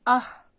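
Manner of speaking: "आह" sung out loud, a breathy "ह" at the end; visarga demo. Shown as a demonstration. Teacher demonstrates visarga to children, loud "आह". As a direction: call out "आह" with the breath clearly heard. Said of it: "मैं पढ़ करके आपको बताती हूँ".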